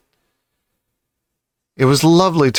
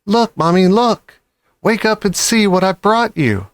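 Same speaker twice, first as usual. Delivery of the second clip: put-on high, eager child voice; excited child; 7 semitones higher than usual. A narrator's reading. Cub showing his starving mother the hens, eager and high. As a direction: wake her up with the good news.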